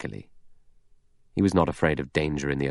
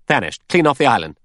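Lombard emphatic; 6 semitones higher, 5 dB louder than usual. A narrator's reading. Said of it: "Lombard said".

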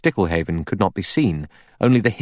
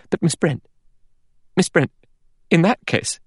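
high, urgent appeal; alarmed; 10 semitones higher, 3 dB louder than usual. A narrator's reading. Vera scrambling up, appealing to Miss Brent in alarm. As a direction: break in with alarm, voice pitched up.

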